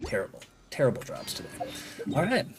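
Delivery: ordinary talk, nothing marked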